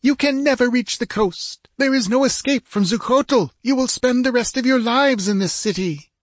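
high-pitched, urgent warning; insistent princess, pitched high; hasty, emphatic woman. Acted. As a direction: let the words trip out in a high female voice. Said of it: "spoke loudly, her words tripping in her haste".

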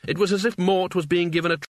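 ordinary delivery

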